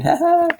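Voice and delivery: squeaky ha-ha; silly high laugh. Pretend. Laughing at the giant hand, high silly laugh. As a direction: pop up into a cartoon laugh.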